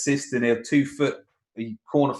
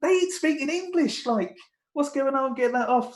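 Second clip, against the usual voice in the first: much higher, mock-indignant; outraged disbelief; 13 semitones higher than usual. Dad mock-outraged at the Welsh-language cartoon, high indignant. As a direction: demand they turn it off, comically baffled. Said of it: "I'm watching, like".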